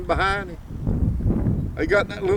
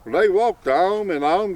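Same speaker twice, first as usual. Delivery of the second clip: ordinary delivery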